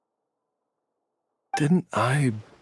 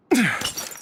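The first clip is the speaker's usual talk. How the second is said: long sigh; 12 semitones higher than usual. Acted. Heavy sigh at final farewell.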